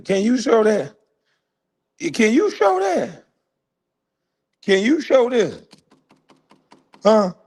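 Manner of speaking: high, mocking repeated challenge; taunting challenge. Real, not acted. Taunting a rival who called him a deadbeat, high jeering challenge. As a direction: jeer at him to prove it, pitch climbing.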